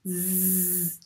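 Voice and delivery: Z as a buzzing zzz; Z sound. Shown as a demonstration. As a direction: hold the buzz on Z. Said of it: "On l'a prononcé". (le son Z bien appuyé)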